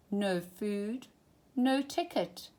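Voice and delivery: plain reading voice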